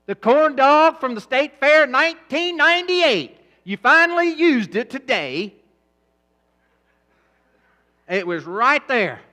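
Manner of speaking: loud, high, slow preacher cry; full-force preaching. Acted. Preacher to congregation about stored fat, loud preaching. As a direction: drive each word home to the crowd.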